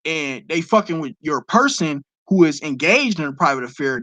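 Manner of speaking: ordinary delivery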